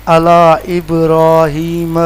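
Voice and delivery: علی ابراہیم drawn out slowly; teacher reciting an example slowly; slow recitation. Shown as a demonstration. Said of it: "teacher reads example words".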